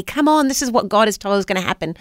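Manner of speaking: ordinary talk, nothing marked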